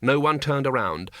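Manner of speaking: ordinary delivery, nothing marked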